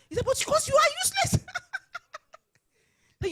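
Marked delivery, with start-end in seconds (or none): 0.2-1.5 very high, loud mock retort; imitating an exasperated boss, voice shooting up; retold yelling. Chairman answering the crying woman, yelling back.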